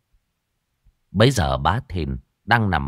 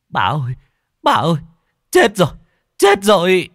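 high, loud cries of alarm; frantic calling, rising in pitch and volume; panicked servant; 10 semitones higher, 7 dB louder than usual. A narrator's reading. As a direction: raise the alarm in breathless bursts. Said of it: "thằng đầy tớ hớt hải chạy về".

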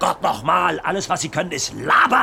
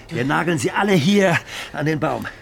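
usual speaking voice

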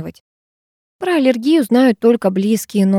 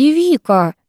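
high, irritated name-call; peeved rebuke; exasperated; 7 semitones higher than usual. A narrator's reading. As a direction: scold a friend with a pained groan in it. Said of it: "скривилась Карина".

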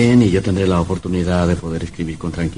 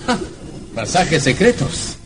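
excited surprise; 10 semitones higher, slower than usual. Acted. Young novelist amazed at the secret passage. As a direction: marvel at the secret door.

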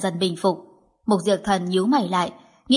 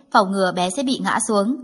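ordinary delivery

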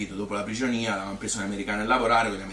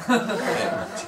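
laughing; 12 semitones higher, 3 dB louder than usual. Real, not acted. Laughing at forgetting the quarrel.

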